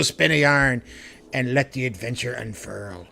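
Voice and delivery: loud hammy pirate, growling out arr; swashbuckling pirate captain spinning a yarn. Acted. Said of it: "describe R P Gs as if you were a pirate".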